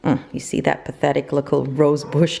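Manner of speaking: usual speaking voice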